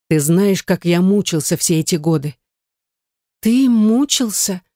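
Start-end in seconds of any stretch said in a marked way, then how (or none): 3.4-4.6 disbelieving voice. A narrator's reading. Gayne questions David's suffering, disbelieving. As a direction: draw it out in disbelief. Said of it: "спросила она неверящим голосом".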